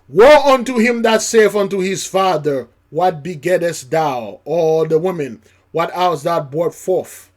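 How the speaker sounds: loud, ringing scripture reading; preaching scripture. Acted. Preacher booming scripture to congregation, loud. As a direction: proclaim it from the pulpit.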